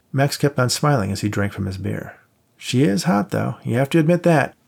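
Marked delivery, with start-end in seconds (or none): 2.5-4.5 a hint of a higher, teasing pal; lightly voiced buddy. A narrator's reading. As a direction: play the cocky friend lightly. Voiced friend Max.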